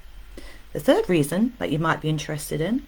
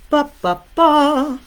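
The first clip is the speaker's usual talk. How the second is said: loud, high vocal fanfare; mock fanfare; 10 semitones higher, 9 dB louder, slower than usual. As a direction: trumpet it out as a big reveal. Mock fanfare announcing the rule.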